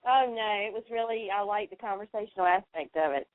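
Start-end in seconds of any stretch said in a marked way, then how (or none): none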